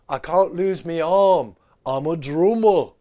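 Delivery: slow, loud mock Liverpool accent, me arm; hammed-up Scouse actor in a hospital bed; fake Scouse accent. Quoting the movie's [name] in mock Scouse. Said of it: "he was channeling [name] for his fake British accent".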